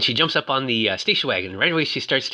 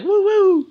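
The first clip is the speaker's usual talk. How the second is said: high, loud mock "woo-woo"; pitched-up fan cheer; imitated crowd whoop; 6 dB louder than usual.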